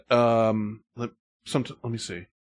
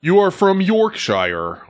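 mock British accent; 6 semitones higher, 5 dB louder than usual. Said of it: "teasing the emailer".

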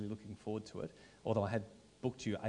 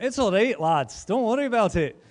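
put-on Scottish accent; 10 semitones higher, 12 dB louder than usual.

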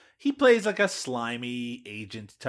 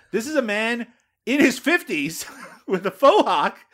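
high and loud, laughing through the words; laughing disbelief; 10 semitones higher, 10 dB louder than usual. Real, not acted. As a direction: crack up while pointing out the faux hawk. Host mocking actor's faux hawk.

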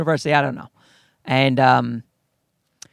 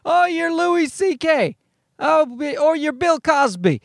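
mock starstruck fan; 9 semitones higher, slower than usual. People recognizing famous comedians.

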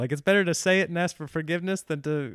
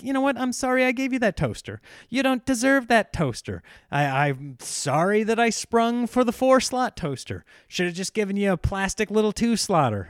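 mock resentful spouse; 7 semitones higher than usual. Spouse voiced, regretting wedding gift.

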